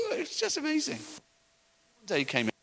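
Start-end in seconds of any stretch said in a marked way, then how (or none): none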